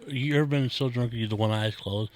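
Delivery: ordinary talk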